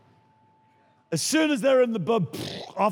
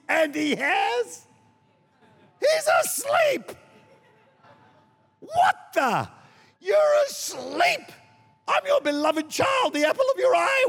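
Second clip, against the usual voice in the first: much higher, strained yelling; mock hysterical wail; 8 semitones higher than usual. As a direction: whine to God like a neglected child. Believer accusing sleeping God.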